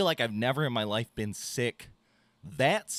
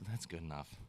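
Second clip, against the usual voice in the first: much softer echo of the same words; muttered repeat; 6 semitones lower, 13 dB quieter than usual. Retold or imitated. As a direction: let the repeat fall away under your breath. [name] repeats, trailing off.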